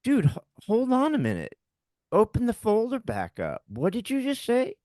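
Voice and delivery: high-pitched, stunned demand; halting disbelief, pitch way up; retold shock. Husband demanding the doctor repeat the diagnosis, in shock. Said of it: "in shock".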